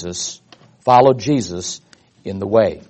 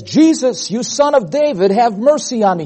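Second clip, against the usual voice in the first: crying out; 8 semitones higher than usual. Acted. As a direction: call out for mercy, pitched high. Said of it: "He begins to cry out".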